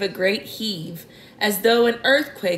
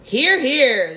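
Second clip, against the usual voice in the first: higher, louder cheer of agreement; group shout; 4 semitones higher, 5 dB louder than usual. A narrator's reading. All the creatures agreeing with the ladybird, shouted approval. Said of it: "they all shouted".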